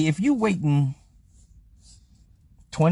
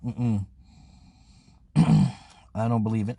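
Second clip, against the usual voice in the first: ordinary talk, nothing marked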